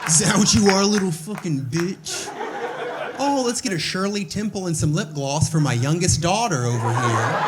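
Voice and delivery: hammed-up aggressive mom, rising to a loud taunt; mock-bully him like a tipsy mother; mocking drunk mom. Drunk mom taunting her son, hammed-up shouty mockery. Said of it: "She'll be like".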